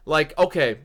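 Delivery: ordinary talk, nothing marked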